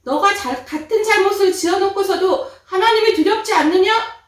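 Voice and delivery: loud, high stern rebuke; the other robber rebuking the mocker, loud and high. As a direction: scold him up high and loud. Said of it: "한 강도는".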